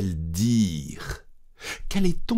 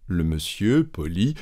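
ordinary delivery

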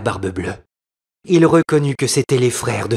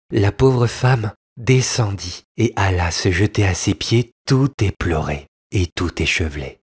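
usual speaking voice

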